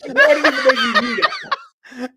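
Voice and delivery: high, laughing; amazed laughing tease; laughing disbelief. Real, not acted. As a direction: squeal it through laughter. Laughing at friend's slang.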